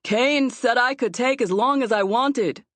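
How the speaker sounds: put-on young officer answering from a distance; boy calling back. A narrator's reading. Boy calls back to gruff man.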